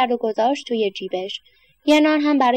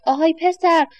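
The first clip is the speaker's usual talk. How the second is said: put-on man shouting at boy; 6 semitones higher than usual. A narrator's reading. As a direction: call him down sharply, raised voice. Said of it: "سرش داد زد".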